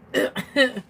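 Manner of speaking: sharp cough after choking. Real, not acted. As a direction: cough out what went down wrong. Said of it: "it went down the wrong pipe".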